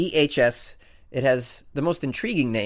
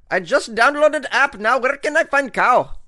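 high, loud, slow mock Indian accent; 10 semitones higher, 7 dB louder than usual. Host mocking an eager cow-selfie user in a high mock Indian accent. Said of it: "I have an amazing Indian accent".

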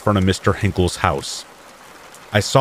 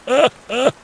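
high wild cackle; crazy old man laugh. A narrator's reading. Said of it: "Mister Hinkle yelled and laughed".

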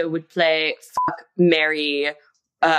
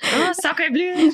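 put-on slow, much higher French accent exclamation; french chef; 10 semitones higher, slower than usual. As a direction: camp French chef gasp.

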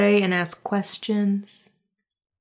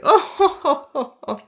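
mock polite laugh; 6 semitones higher, 4 dB louder than usual. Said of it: "she's like".

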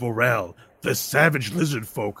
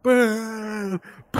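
high, jeering crowd boos; crowd booing; 10 semitones higher than usual. Acted. Crowd boos the fighter, high jeering. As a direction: drown him out like a hostile crowd.

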